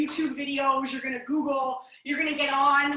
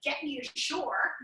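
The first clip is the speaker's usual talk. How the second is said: ordinary talk, nothing marked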